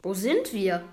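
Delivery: ordinary delivery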